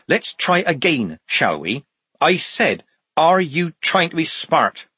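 high, slow, deliberate repeat; stern demand. A narrator's reading. Sergeant Polly repeating her challenge to the guard, slow and demanding. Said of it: "she demanded".

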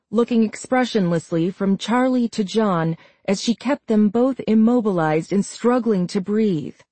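ordinary delivery, nothing marked

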